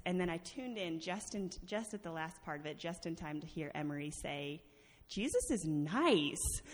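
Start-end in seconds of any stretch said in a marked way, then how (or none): 5.1-6.6 put-on slow, loud child's declaration with swooping pitch; imitated little girl. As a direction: draw it out like a small child. Imitating child talking to sister.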